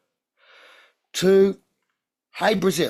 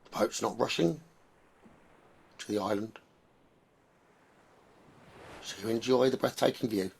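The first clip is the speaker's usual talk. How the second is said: guided meditation; 2 semitones lower, 4 dB quieter than usual. Acted. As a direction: lull them gently toward the island.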